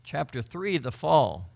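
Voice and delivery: ordinary talk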